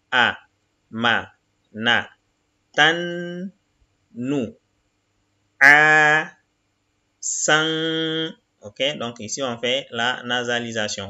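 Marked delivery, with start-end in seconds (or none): 0.1-8.3 each Arabic syllable said apart, slowly; syllable reading. Shown as a demonstration. Teacher reading Arabic syllables to the class, slow and separated. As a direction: spell the word out syllable by syllable.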